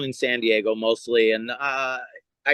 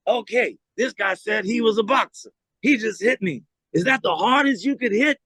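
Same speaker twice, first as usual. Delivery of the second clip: mock taunt; 7 semitones higher than usual. Ali taunting him before crowd.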